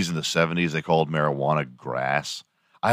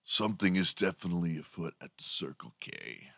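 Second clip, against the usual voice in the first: hushed teen-dude impression, much softer; Bill and Ted movie dude; 12 dB quieter than usual. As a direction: mimic the movie dude, quietly intrigued. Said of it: "Remember Bill and Ted's Excellent Adventure?".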